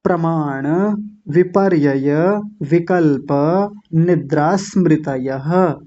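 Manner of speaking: slow measured sutra, pausing after each word; प्रमाण, विपर्यय, विकल्प, निद्रा स्मृतयः one by one, endings sounded; word-by-word model. Shown as a demonstration. Teacher reviewing all words, slow word-by-word recitation. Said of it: "अब देख लीजिए सारे शब्दों को".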